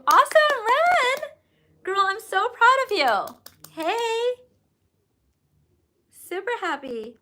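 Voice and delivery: high, loud delighted cheer for a viewer; excited cheer. Real, not acted. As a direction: burst out cheering, squealing with pride. Cheering a viewer's good news.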